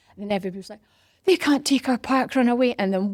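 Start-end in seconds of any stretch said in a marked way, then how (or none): 1.3-2.7 loud, high protest; mock the crowd's outraged cry; indignant outcry. Crowd hearing Park Run may close, indignant outcry.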